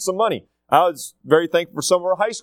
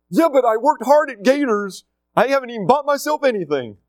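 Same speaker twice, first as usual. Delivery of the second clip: whiny son; 8 semitones higher than usual. Retold or imitated. Son arguing with dad.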